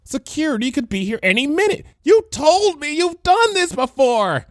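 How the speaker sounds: rising panic, barely holding on; panicked Bon Bon. A narrator's reading. Bon Bon scolding Lyra, frantic and high. Bon Bon's voiced lines.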